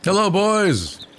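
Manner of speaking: slow, lordly hello from a vain bard; pompous bard greeting. Acted. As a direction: greet them as if you're doing them a favour. Christophorus greets priest's sons.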